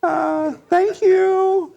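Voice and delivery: very high, slow falsetto customer greeting; hammed-up high falsetto cashier greeting. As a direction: put on a high, sugary shop-clerk voice.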